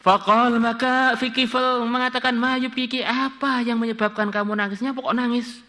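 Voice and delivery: high-pitched chant-like text reading; pembacaan kitab. Acted. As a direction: read the quoted question out high and sustained. Membaca kitab, ucapan Kifl. (membaca kitab dengan nada tinggi)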